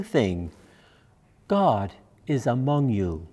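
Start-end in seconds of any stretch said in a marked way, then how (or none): none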